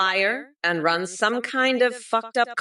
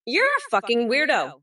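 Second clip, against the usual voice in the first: normal talking voice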